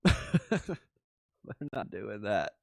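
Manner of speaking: slow, laughing refusal. Real, not acted. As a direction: chuckle through it, drawn out. Repeats it slowly, laughing.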